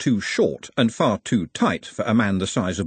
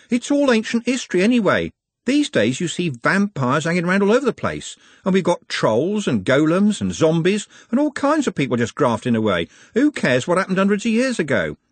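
high-pitched, animated brush-off; exasperated, lively Trev; dismissive Trev; 10 semitones higher, 3 dB louder than usual. Acted. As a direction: argue it away with pitch riding high.

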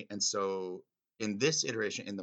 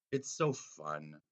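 ordinary talk

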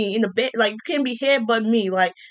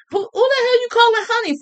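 much higher, outraged question; indignant retort; 12 semitones higher, 3 dB louder, faster than usual. Real, not acted. Telling off a Tinder match who called her honey, outraged. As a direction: snap back at the cheek of it.

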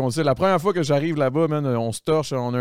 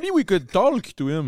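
put-on French-accented English; 6 semitones higher, slower than usual. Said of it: "J'adore ton anglais".

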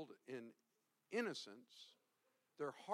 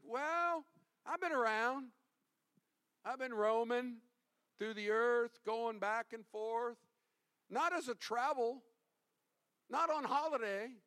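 a hint of a sly, vague devil, louder and higher; evasive Satan; 8 semitones higher, 6 dB louder than usual. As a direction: shrug it off like a slippery liar. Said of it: "Satan answered".

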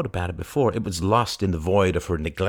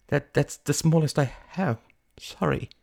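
put-on higher, hesitant young customer; apologetic; 7 semitones higher than usual. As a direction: stammer a small, meek apology. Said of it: "apologized Mary".

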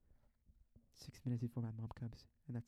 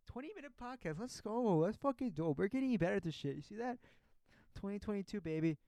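excited; 8 semitones higher than usual. Real, not acted. Celebrating finishing the podcast.

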